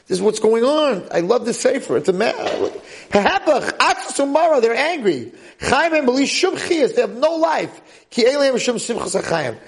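high-pitched, fervent exposition; impassioned preaching. Real, not acted. Rabbi expounding on people with no life, impassioned. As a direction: preach the text with rising fervor. Impassioned reading of a holy book.

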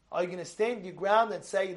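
ordinary talk, nothing marked

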